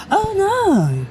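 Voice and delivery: high squeaky oh no; caught-out gasp. Retold or imitated. The caught performer on seeing the unfollow, a squeaky mock dismay. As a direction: sound caught red-handed.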